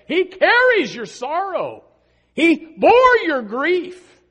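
high, loud, drawn-out preacher's cry; impassioned pulpit shout; full-force preaching. Real, not acted. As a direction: preach it at the top of your voice, stretching key words.

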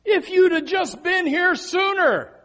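loud, high mock cry of complaint; hammed-up shouted reproach; acted reproach. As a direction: cry it out like an accusing mourner. Acts mourners' reproach to Jesus.

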